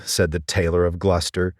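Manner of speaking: plain reading voice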